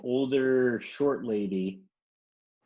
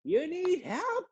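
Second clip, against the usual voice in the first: very high, slow, squeaky imitation of the lady; high mock lady voice; slower than usual. Lady offering help to the stuck driver, very high squeaky mock voice. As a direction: ham up a tiny old lady asking. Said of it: "she was like, she was like".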